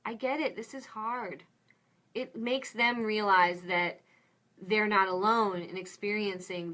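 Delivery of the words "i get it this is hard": soft, higher, tender reassurance; soothing validation. Shown as a demonstration. Parent soothing an upset little kid, soft and tender. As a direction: comfort them like a hurting child. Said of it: "That is such a great thing to say to little kids".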